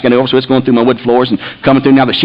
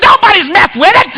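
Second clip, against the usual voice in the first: high, indignant denial; defensive yelling. Retold or imitated. Wife denying the thermostat was touched, defensive. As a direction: imitate a wife snapping back. Acted argument over thermostat.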